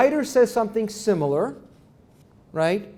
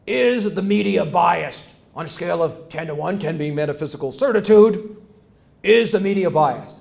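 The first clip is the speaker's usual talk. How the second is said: John McLaughlin impression. Lecturer voicing the host grilling his panel, mock moderator voice. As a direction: announce the issue like a TV moderator. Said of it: "McLaughlin says".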